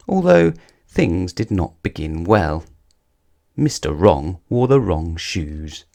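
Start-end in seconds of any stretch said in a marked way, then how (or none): none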